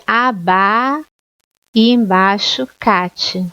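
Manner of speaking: aba, pause, then cate said slowly apart; split into syllables. Shown as a demonstration. Teacher showing the correct end-of-line split, slowly. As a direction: break abacate into aba and cate. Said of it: "se for separar no fim de linha".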